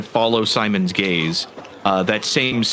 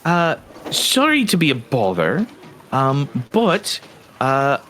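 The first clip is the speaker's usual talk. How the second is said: higher, tentative voice full of little hesitations; polite, hesitant satyr; 6 semitones higher than usual. Acted. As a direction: broach an odd question apologetically, pitched up. Game master plays the approaching satyr.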